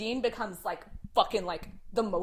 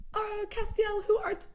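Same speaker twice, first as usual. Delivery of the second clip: high, mock-solemn prayer voice; hammed-up praying in a high singsong; Dean; 12 semitones higher than usual. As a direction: intone it like a silly prayer, pitched up and even. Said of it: "that's, like, how they talk".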